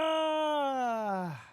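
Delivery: loud strained cry of real frustration; frustrated yell. Real, not acted. Streamer venting at viewers about the missed moment, strained cry. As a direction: let out a short anguished shout. Frustrated outburst mid-rant.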